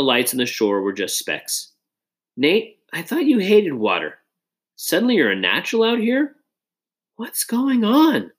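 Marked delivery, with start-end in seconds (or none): 2.4-8.3 lightly voiced teasing buddy, a bit higher; teasing friend Josh. A narrator's reading. Best friend ribbing Nate about a girl, lightly teasing voice. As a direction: needle him like a grinning best friend.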